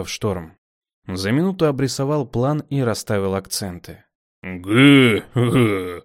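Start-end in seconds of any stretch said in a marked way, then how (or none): 4.4-6.0 loud stammering grunt; dim-witted approving grunt. A narrator's reading. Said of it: "одобрил Костлявый".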